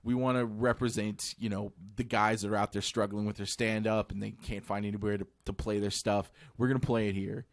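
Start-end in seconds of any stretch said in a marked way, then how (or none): none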